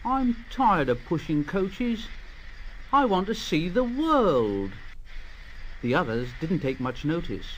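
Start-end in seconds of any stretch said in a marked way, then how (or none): 0.0-4.7 lightly voiced grumbling engine; grumbling Thomas. A narrator's reading. Thomas grumbling to himself in the shed, a touch raised. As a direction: grumble it softly and higher. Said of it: "Thomas used to grumble".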